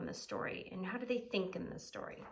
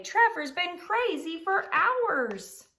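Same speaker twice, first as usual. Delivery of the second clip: high, loud and slow tattle; sly pug excuse; 10 semitones higher, 9 dB louder, much slower than usual. Acted. Pig fibs about Trevor in a high, slow, loud voice. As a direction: drag out the lie sweetly.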